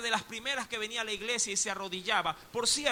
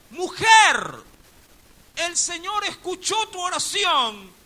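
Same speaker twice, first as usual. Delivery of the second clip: forceful shout; 10 semitones higher, 12 dB louder than usual. Retold or imitated. Pastor shaking the woman by the shoulders, shouting. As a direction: shake her awake with your voice. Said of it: "la tomó por los hombros, la sacudió".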